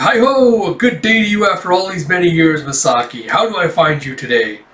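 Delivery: grandly jovial rehearsed welcome; hammed-up greeting. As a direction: open on a high, booming hail and ease down. Rehearsing an over-hearty greeting.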